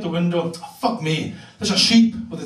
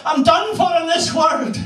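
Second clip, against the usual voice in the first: hammed-up deathbed granny; dying granny; 10 semitones higher than usual. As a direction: wail it like a granny on her deathbed. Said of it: "She said to me".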